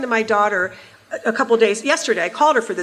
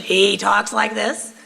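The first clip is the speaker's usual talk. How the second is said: slow, rough-edged impression of the husband talking; mock husband voice, words stretched slowly; Sherrod's voice; slower than usual. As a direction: imitate a husband's distinctive speaking voice, drawn out. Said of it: "ever heard his voice on television".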